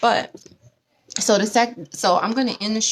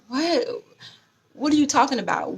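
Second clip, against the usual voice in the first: baffled husband; 6 semitones higher than usual. Retold or imitated. Confused husband reacting to rant.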